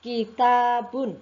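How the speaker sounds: "Kitabun" slow, high and loud, long ta; pronunciation drill. Shown as a demonstration. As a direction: lead a class repeating "Kitabun". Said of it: "Kita ulang bersama-sama".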